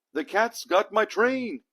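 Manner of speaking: slow, high, loud panicked yell; panicked cry. A narrator's reading. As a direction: wail it out in alarm. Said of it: "cried the engineer".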